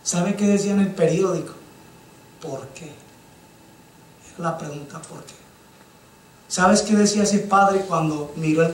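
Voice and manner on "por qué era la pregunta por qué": near-whispered, much softer; hushed why. Retold or imitated. The preacher reads the newspaper's question, hushed. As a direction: breathe the question in grief.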